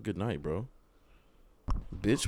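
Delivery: normal talking voice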